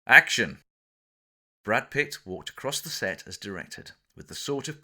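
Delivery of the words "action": loud call of action; film director calling the take, shouted; director's shout. Retold or imitated. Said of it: "the director shouted".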